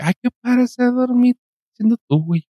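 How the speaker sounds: high, swooning mock fan; mock starstruck fan voice; mock fan gush. Mocking a fan's gushing.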